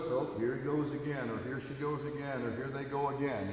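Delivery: very soft, under-the-breath complaint; mock grumbling aside. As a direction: grumble it privately, barely voiced. Listeners privately grumbling about a teacher.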